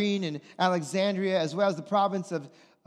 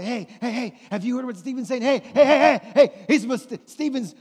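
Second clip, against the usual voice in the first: higher and much louder, stirring up a mob; agitated gossiping crowd; 5 semitones higher, 6 dB louder than usual. Retold or imitated. Preacher acting out Stephen's accusers rallying a mob, loud and worked up. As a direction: whip the crowd into a fight, voice raised. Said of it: "They're going around and say".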